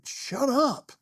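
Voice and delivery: high pitched inner rebuke; scolding inner voice. Retold or imitated. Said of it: "I'm thinking".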